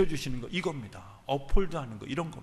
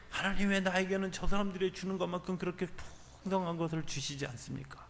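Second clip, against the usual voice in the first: resentful complaint; 7 semitones higher, 5 dB quieter than usual. Retold or imitated. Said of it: "막 원망했던 때가".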